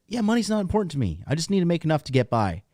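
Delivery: high, fast, mock-carefree voice; a hammed-up clueless dude, high and quick; naive guy mock voice. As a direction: parody a guy who says money doesn't matter. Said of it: "you're just like".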